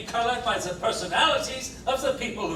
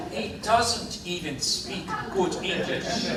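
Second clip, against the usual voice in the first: slow, deliberate stress on "doesn't"; pointed correction; slower than usual. Acted. Juror correcting another's grammar, slowly stressing "doesn't". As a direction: draw out the sentence, marking "doesn't" as the fix.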